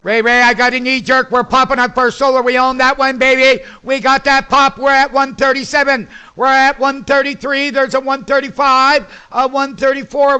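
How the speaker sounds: loud, high, rapid excitement over the earnings pop; thrilled trader calling out the jump; excited. Real, not acted. Trader yelling to Ray Ray about the stock pop, excited. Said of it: "Excuse me if I sound excited".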